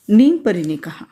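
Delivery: usual speaking voice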